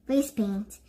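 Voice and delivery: usual speaking voice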